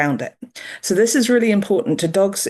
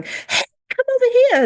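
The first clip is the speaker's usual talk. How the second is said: much higher, bright sing-song call with a fall on over; sing-song kid-calling voice. Shown as a demonstration. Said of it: "talk to a little kid and you want them to come over".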